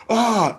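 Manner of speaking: high shocked ah; startled gasp. Retold or imitated. Kid recoiling when mom pulls out her teeth. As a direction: recoil at the sight.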